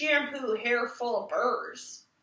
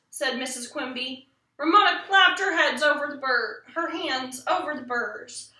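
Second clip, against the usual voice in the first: plain reading voice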